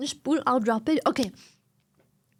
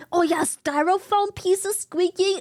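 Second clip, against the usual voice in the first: sharp disgusted gasp; horrified gasp; 5 semitones higher than usual. Real, not acted. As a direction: gasp in horror. Reacting to styrofoam sound.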